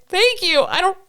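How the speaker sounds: high-pitched mock-weepy relief; imitated tearful gratitude. Traveller thanking the officer who let her go, acted tearful relief. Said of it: "I started crying".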